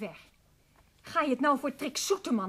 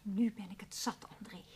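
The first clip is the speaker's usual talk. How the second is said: hissed anger; 3 semitones lower, 8 dB quieter than usual. Acted. Ruzie, fluisterend kwaad.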